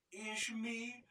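high, small voice on the verge of tears; a little creature about to cry; tearful. A narrator's reading. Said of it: "pityeredett el Kajtánka". (magas, pityergős kis hang)

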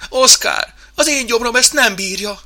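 high, loud queasy protest; groaning complaint. Acted. Sick mouse pleads on the ride, high groaning complaint. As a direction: moan that your stomach can't take it. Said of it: "nyögött Benc úr".

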